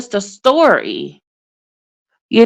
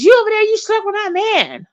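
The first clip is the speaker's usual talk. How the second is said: high mocking imitation of her anger; hammed-up accusing rant; lightly faked growl; mock growly voice; 10 semitones higher, faster than usual. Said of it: "using your growly voice".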